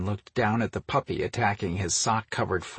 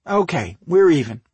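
high, louder grudging concession; 9 semitones higher, 6 dB louder than usual. Acted. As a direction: give in grudgingly, pitched high and loud. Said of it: "she grudgingly replied".

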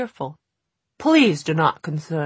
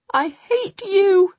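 slow, much higher, thin trembling shriek; 4 dB louder, slower than usual. A narrator's reading. Jessamyn shrieking at the goblin, thin and trembling. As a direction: shriek it with hatred. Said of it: "Jessamyn shrieked, her voice thin and trembling".

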